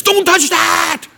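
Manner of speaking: high, loud, drawn-out yell; urgent high-pitched scolding shout; emphatic warning. Acted. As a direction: shout it like warning a little kid. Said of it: "you need to be very emphatic".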